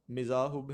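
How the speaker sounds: مذاہب as "mi-zaa-hub", i first, u last; pronunciation option. Shown as a demonstration. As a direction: say مذاہب starting "mi", ending "hub". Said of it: "درست لفظ کو کیا ہوگا".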